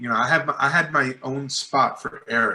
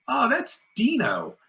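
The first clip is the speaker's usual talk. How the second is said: light director voice; 10 semitones higher, slower than usual. Retold or imitated.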